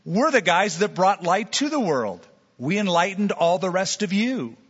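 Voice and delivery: high, incredulous scoff from proud Greek thinkers; scoffing philosophers. Pretend. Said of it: "They would laugh at you and say".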